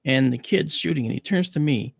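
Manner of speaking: ordinary delivery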